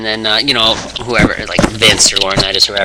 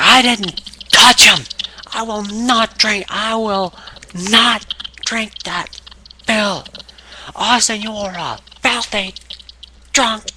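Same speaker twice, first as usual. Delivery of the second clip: slow, high-pitched hammed-up outrage; mock indignant refusal; 11 semitones higher, slower than usual. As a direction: space out each word in put-on fury. Acting C M Punk's enraged refusal.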